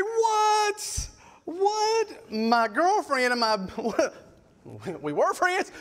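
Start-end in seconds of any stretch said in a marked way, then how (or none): 0.0-2.3 very high squawk of disbelief; hammed-up, high-pitched outburst; mock shocked. Boyfriend hearing the confession, shrieked mock disbelief.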